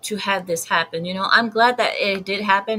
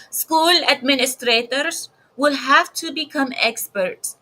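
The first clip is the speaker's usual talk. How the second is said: higher-pitched mock Spanish accent; 5 semitones higher, 3 dB louder than usual. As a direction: put on your best Spanish accent, pitched up. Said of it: "here's my best Spanish accent".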